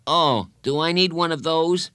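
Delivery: lightly played boy's question; boy character. A narrator's reading. Bradley's dialogue voiced in narration.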